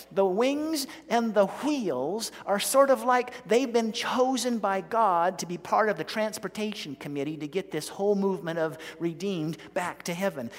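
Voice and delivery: ordinary delivery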